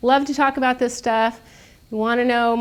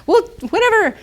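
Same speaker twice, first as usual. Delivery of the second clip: mock dismissive shrug; 13 semitones higher, 8 dB louder than usual. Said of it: "the marketing department".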